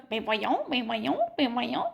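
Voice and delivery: students mimicking her accent, repeating it back; mocking Quebec accent. Students teasing the intern by repeating her Quebec accent. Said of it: "Ils vont me répéter avec mon accent".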